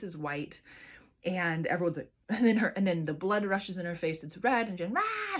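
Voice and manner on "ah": high squeaky "Ah" like a newborn's first wail; imitated newborn cry. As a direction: mimic the baby's first cry, pitched way up. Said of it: "she's crying".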